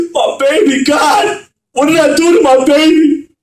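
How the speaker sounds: grieving father crying out over his dead son, anguished; anguished wail. Acted.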